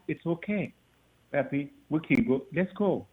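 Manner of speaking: calm him down softly; soft reassurance. Retold or imitated.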